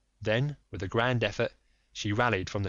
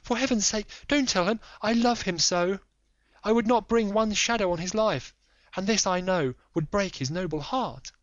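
high, loud tearful pleading; desperate pleading; 11 semitones higher, 4 dB louder than usual. Acted. Lady begging Holmes to stay silent, desperate high plea. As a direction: plead for her husband's sake. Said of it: "frenzy of supplication".

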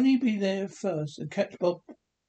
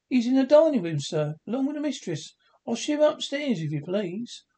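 higher, bright maid's answer; servant girl; 7 semitones higher, 3 dB louder than usual. A narrator's reading. Maid tells Scrooge where the master is, higher voice. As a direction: answer like a young housemaid.

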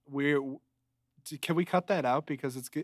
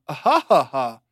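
sarcastic laugh; 8 dB louder than usual. Retold or imitated. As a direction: fake flat ha ha ha, dry and mocking. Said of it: "But that was sarcastic".

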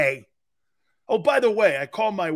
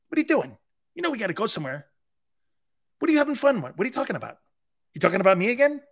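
imitating his wife; 4 semitones higher, much faster than usual. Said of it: "your wife coming in and being Captain Evil".

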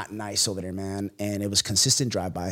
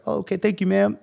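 surprised thanks; 10 semitones higher, 4 dB louder than usual. Retold or imitated. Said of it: "And I was like".

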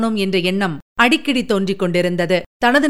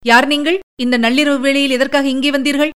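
higher, commanding woman's challenge; imperious questioning in a raised pitch; authoritative demand; 5 semitones higher than usual. A narrator's reading. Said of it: "அதிகாரத் தொனியில் கேட்டாள்".

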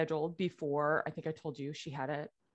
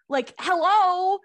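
much higher and louder, slow drawn-out hello; incredulous; 13 semitones higher, 10 dB louder, slower than usual. Real, not acted. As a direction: stretch it like it's painfully obvious. Obvious sign from late dad.

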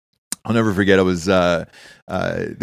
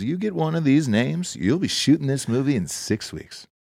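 laid-back financier; 5 semitones higher than usual. Retold or imitated. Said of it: "he talked like that".

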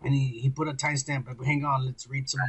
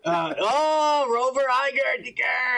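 excited shout-out; 6 semitones higher, slower than usual. Real, not acted. Host shouting a hype shout-out to a fan. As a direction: hype him up like a DJ.

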